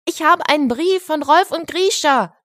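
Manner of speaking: high, excited proclamation of news; excited calling out. A narrator's reading. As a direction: announce it excitedly to the whole room. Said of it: "rief er laut".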